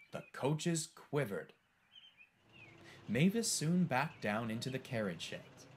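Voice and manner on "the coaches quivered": usual speaking voice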